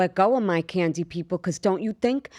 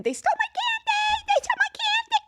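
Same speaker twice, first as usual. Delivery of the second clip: put-on very high child's wail; screaming little kid. Robbed little girl screaming to the neighbors, high child wail. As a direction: squeal it like a robbed six-year-old. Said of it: "I'm screaming".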